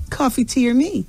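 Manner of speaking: sweet cabin-crew offer; flight attendant voice. Retold or imitated. Mock flight attendant line.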